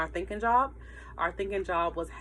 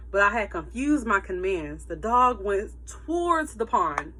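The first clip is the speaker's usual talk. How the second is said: ordinary delivery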